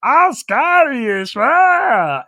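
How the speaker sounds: loud, high, drawn-out bad Scottish accent; mocking a failed Scottish accent, slow and loud; Brittany's attempted Scottish accent. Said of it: "trying to do a Scottish accent with her accent".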